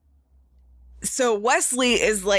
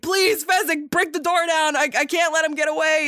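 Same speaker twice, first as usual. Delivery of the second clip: higher, desperate plea to break the door; pleading; 7 semitones higher than usual. Retold or imitated. Inigo begging Fezzik through the locked door, pitched-up pleading. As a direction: beg your big friend for help, voice pitched up. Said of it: "Inigo's pleading to Fezzik".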